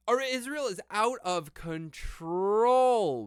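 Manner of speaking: slow, high, loud outrage; outraged. Real, not acted. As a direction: drag out the outrage. Outraged, drawn-out exclamation.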